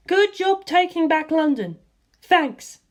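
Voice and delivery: put-on excited young woman voice. A narrator's reading. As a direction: gush over him after the hug. Lisa reads signal aloud.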